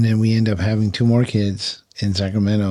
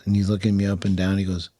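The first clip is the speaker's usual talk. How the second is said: usual speaking voice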